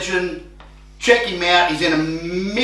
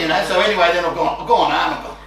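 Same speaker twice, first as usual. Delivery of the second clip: usual speaking voice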